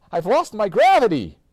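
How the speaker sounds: loud alarmed cry; panicked cry. A narrator's reading. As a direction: shout it in a panic. Floating boy panics.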